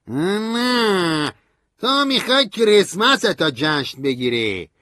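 high, loud, slowish scornful voice; bitter. A narrator's reading. Stanley mocks narrator's Christmas party, bitter and loud. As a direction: taunt him bitterly.

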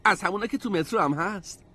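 put-on high-pitched eager kid voice; excited. A narrator's reading. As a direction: chime in, thrilled. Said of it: "تری هیجان زده گفت".

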